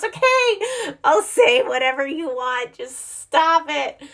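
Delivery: high, pleading surrender, slow at the end; desperate giving in. Retold or imitated. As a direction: beg the toddler to stop. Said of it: "until the person finally goes".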